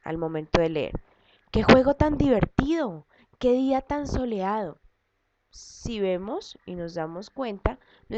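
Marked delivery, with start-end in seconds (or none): 1.5-4.8 high-pitched demonstrated exclamations; teacher modelling exclamatory reading; exclamation intonation. Shown as a demonstration. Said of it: "tenemos que hacer una entonación".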